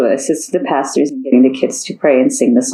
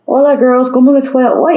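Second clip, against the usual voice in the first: cheerful dad voice; 8 semitones higher than usual. Acted. Father Juan voiced.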